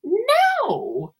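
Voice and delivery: very high, loud, laughing protest; indignant denial. Real, not acted. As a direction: shoot the question down in outraged amusement. Indignant denial to co-host's question.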